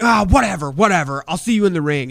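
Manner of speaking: loud, high scoffing; dismissive brush-off. Retold or imitated. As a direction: wave him off with contempt. Dismissing the rookie's plan.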